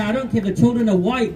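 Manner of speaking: ordinary talk, nothing marked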